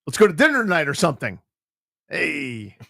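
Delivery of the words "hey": ordinary talk, nothing marked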